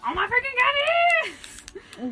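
very high, loud squeal; excited shriek. Acted. Mocking a story character's reaction, excited shriek. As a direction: shriek it in hammed-up amazement.

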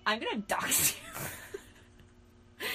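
high, drawn-out threat cracking up; laughing mock threat. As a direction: threaten while dissolving into laughter. Joke threat breaking into laughter.

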